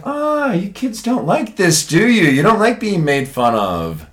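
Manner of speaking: slow, high teasing lilt; mock taunting. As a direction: rub it in to the bullies, drawn out. Teacher taunting the bullies.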